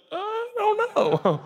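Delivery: laughing, caught out; sheepish admission. Retold or imitated. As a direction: caught not listening, laugh it off. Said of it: "You'd be like".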